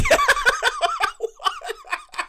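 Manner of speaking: a shrill burst of laughter; high squealing laugh. Real, not acted. Laughing at his own bacteria joke, high squeal.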